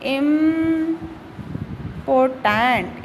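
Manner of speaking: Important drawn out, broken into syllables; sounded out slowly. Shown as a demonstration. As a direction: hold the first syllable of important, then the rest slowly. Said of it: "इसका जो pronunciation है वो लिख के देखते हैं".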